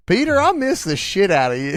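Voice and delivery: high, loud bro greeting; peppy breathy workout coach. Pretend. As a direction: shout it like a hyped trainer. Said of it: "She talks like she's your personal trainer".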